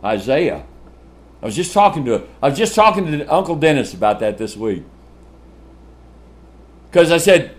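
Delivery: usual speaking voice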